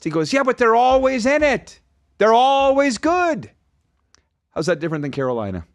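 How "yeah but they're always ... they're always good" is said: high, drawn-out protest as the pizza man; imitated pizza guy. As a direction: mimic him insisting, slow and high-pitched. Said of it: "he's making the pizza".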